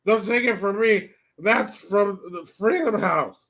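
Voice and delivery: put-on slurred, dragged-out drunk hag voice; old drunk politician, halting and slurring, stumbling over words; Nancy Pelosi. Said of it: "Let me say it how she said".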